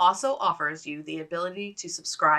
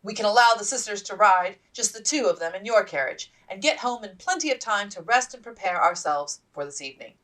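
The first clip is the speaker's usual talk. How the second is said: commanding; 4 semitones higher, 7 dB louder than usual. Acted. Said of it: "issued orders like the practiced officer wife".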